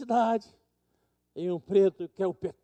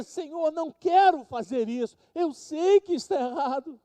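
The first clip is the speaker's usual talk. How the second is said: Paul begging the Lord, higher and louder; pleading Paul; 8 semitones higher, 5 dB louder than usual. Retold or imitated. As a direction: cry out to God that you don't want this. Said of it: "Porque ele dizia". (Paulo suplicando a Deus, mais alto e mais forte)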